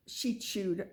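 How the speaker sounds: ordinary delivery, nothing marked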